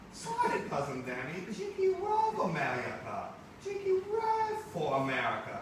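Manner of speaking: high, loud cartoonish put-on cousin voice; exaggerated high cousin voice. Teacher reading the comic to the class, high cartoonish cousin voice. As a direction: play the visiting cousin big and squeaky.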